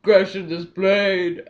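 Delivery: booming, slow villain shout; Bane. Retold or imitated. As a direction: bellow it very loud, dragging out each word. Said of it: "But no, he's like".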